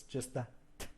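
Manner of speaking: quiet "t" with breath, no voice; voiceless "t" alone; isolated t sound. Shown as a demonstration. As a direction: tap out the "t" softly. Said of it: "the T sound has no voice".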